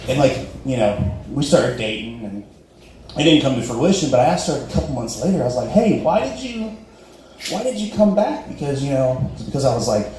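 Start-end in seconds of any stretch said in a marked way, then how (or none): 5.7-8.5 tentative question. Retold or imitated. Asks girlfriend why she returned.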